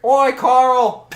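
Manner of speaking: high, loud comic guard's shout; Monty Python guard. Acted. Guard hailing his fellow guard at the gate, Monty Python shout. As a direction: call out to your mate, loud and high. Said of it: "I'm turning into the Monty Python".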